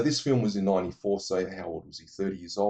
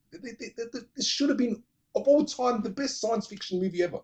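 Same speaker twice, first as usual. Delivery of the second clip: excited gush; 11 semitones higher than usual. Real, not acted. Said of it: "enthusiastic praise of film".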